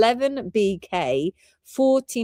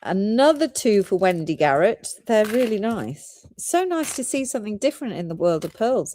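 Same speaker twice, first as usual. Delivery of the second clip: ordinary talk, nothing marked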